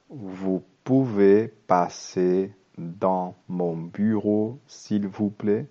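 slow, careful French reading for learners. Shown as a demonstration. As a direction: read each word slowly so students can follow. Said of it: "leer el diálogo muy despacio". (lectura muy lenta y clara)

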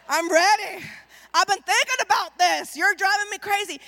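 loud, worked-up excitement; eager husband. Acted. Husband rushing home early to his wife, eagerly. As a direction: burst out with it, loud and impatient.

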